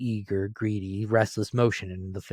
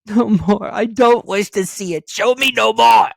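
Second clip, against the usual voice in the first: shouted, much higher and louder; crying out; 13 semitones higher, 12 dB louder than usual. A narrator's reading. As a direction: cry out in desperation. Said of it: "cried Scrooge".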